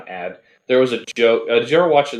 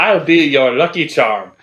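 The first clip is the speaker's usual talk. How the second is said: put-on Irish accent; 5 semitones higher, 7 dB louder than usual. Joining the Irish leprechaun bit.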